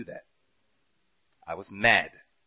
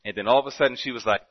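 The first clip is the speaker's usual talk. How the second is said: ordinary talk, nothing marked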